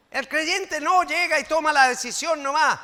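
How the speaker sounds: loud, high denouncing sermon; preaching at full force. Acted. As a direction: hammer the point home loudly. Preacher denounces careless believer.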